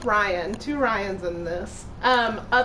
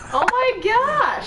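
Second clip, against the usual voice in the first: high, slow gasp of surprise; sudden delighted surprise; surprised; 11 semitones higher, 4 dB louder, much slower than usual. Real, not acted.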